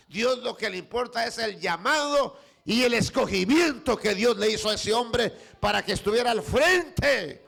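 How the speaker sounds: shouting pulpit preacher, rising in fervour; fiery preaching. Real, not acted. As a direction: preach it out to the whole church. Preaching builds.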